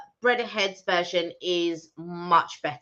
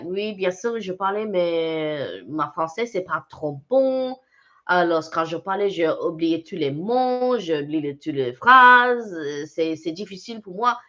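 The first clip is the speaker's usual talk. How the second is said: put-on halting French in a French accent; 4 semitones lower than usual. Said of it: "my accent is a French accent".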